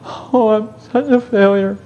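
high, slow mock wail; whiny, drawn-out fake sobbing lament; mock weeping. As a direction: imitate a self-pitying weeper. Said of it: "they're always weeping".

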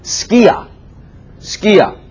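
Skia said high and clear; Greek word skia. Shown as a demonstration. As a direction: pronounce Skia as SKEE-ah.

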